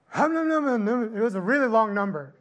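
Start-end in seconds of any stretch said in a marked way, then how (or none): 0.1-1.2 high, loud mock mumbling; mumbled price. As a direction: mumble a huge number nonsensically. Mimicking the vet quoting price.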